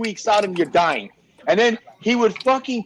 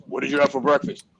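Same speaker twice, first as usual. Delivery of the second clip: mock deep male voice, lower than usual; 4 semitones lower, faster than usual. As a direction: imitate his deep-voiced question. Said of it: "he always had this deep voice".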